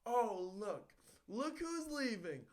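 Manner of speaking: high, slow, drawn-out announcement; mimicked principal. Old principal to the departing graduation audience, slow high imitation. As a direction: imitate a principal pointedly calling people out.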